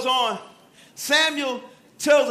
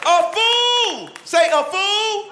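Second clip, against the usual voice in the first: high, drawn-out preacher's holler; loud, ringing proclamation; call-out; 9 semitones higher, 5 dB louder, much slower than usual. Real, not acted. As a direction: call it out for the crowd to repeat. Preacher calls out verse for congregation.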